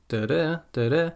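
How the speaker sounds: second "da" lifted upward; sing-song "da-da" stepping up; rising intonation. Shown as a demonstration. Teacher imitates the clause ending tune, "da-da" rising. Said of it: "my voice goes up a bit".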